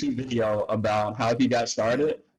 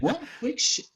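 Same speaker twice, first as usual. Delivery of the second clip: high, baffled question; retold surprise at the notice, pitched high; mock surprise; 14 semitones higher than usual. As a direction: jump way up in disbelief. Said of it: "And I was like".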